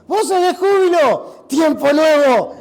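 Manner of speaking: slow, loud jubilant proclamation; preaching at full force. Real, not acted. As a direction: lift the room with joy. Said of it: "preaching at full force". (proclamación fuerte y jubilosa)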